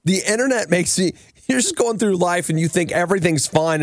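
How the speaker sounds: usual speaking voice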